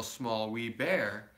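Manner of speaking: ordinary delivery, nothing marked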